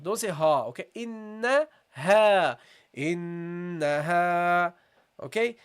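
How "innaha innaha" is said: "Innaha" very slow, long nasal n and light ha; slow drawn-out recitation. Shown as a demonstration. Teacher recites the word slowly for the class. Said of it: "The alif here is light".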